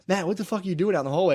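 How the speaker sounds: mock angry coach. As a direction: coach catching him in the hallway, snapping fast and high. Coach yelling at him in hallway.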